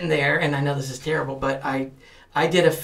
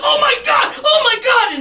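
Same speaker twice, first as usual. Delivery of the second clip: imitating a panicked shout, much higher and louder; panicked bystanders; 6 dB louder than usual. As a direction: cry out in alarm like a frightened onlooker. Bystanders panicking at fake seizure.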